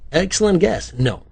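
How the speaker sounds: high, loud mock praise; game show host. A narrator's reading. Writer judges a detective's guess, game show host style. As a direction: praise the contestant like a quizmaster. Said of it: "like a game show host".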